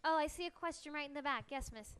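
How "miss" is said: normal talking voice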